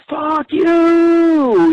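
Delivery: high, loud retort, imitating the girl; mock angry yell. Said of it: "this girl turns around and says".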